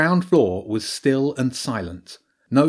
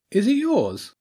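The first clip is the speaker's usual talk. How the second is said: quizzical; 12 semitones higher, slower than usual. A narrator's reading. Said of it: "looked up quizzically".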